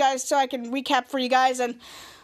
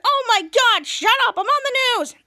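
high, loud acted irritation; hammed-up fed-up yell; exasperated outburst; 8 semitones higher, 4 dB louder than usual. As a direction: tell them off, pitched way up. Hero snaps at interrupting kids.

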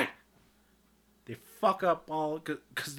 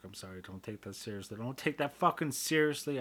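ordinary talk

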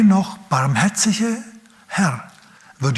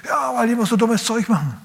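imitierter Vater; 8 semitones higher than usual. Retold or imitated. Imitiert rechtfertigenden lauten Vater.

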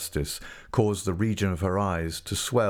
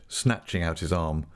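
ordinary delivery, nothing marked

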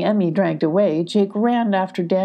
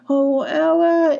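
high, drawn-out wail; crying out; 10 semitones higher, 4 dB louder, slower than usual. A narrator's reading. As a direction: wail a friend's name in distress. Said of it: "Emmy cried".

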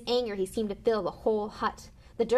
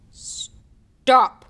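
very loud, higher command; commanding shout; 5 semitones higher, 15 dB louder than usual. A narrator's reading. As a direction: order it to halt at full force. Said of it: "he commanded".

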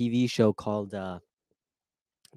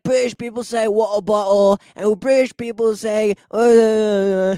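hammed-up British accent, high and loud; mock British accent; 8 semitones higher, 8 dB louder, slower than usual. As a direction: play an American mocking a British accent. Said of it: "American people are always like".

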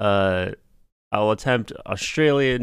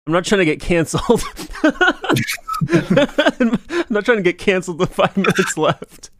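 loud, high, breaking into laughter; laughing protest; 9 semitones higher, 5 dB louder than usual. Real, not acted. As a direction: protest through real laughter. Panicked laugh over cancel joke.

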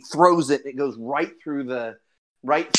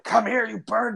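angry character; 8 semitones higher than usual. Acted. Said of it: "he's like".

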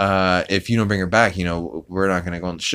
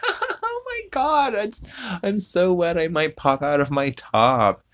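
mocking [name]'s flirty poolside line, very high; mock porn tease; 11 semitones higher than usual. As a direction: ham up a breathy-sounding porn actress line, starting way up high. [name] mimicking a porn-style tease.

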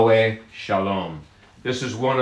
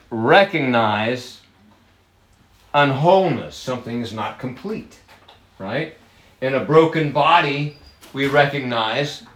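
ordinary talk, nothing marked

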